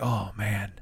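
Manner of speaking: very soft, low aside; muttered to himself. Shown as a demonstration. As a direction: keep it under your breath, for yourself only. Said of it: "that's to me".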